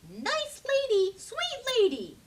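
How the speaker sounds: high chirpy birds; high cooing birds; sweet bird voices. A narrator's reading. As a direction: coo it like flattering little birds. Birds sweet-talking Sophie.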